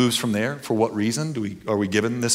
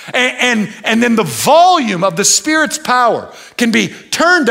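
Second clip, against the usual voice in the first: higher, louder preacher's proclamation; preaching at full force; 9 semitones higher, 7 dB louder than usual. Acted. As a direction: proclaim it to the congregation with fire.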